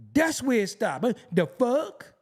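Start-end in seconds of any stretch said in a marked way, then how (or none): 1.3-2.1 slow mock question with a rising end; imitating girls' slang. Ridiculing slang men picked up from women, mimicked rising question. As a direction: draw it out and lift the end. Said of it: "I knew girls who be like".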